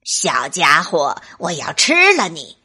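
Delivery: 低沉凶狠的狼声; 像大灰狼一样威胁; 恶狠狠的大灰狼. Acted. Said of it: "恶狠狠地说". (恶狠狠的大灰狼)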